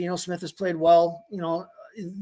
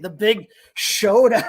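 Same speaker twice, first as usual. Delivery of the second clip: sarcastic; 3 semitones higher, 6 dB louder, slower than usual. Real, not acted. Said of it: "I love sarcasm".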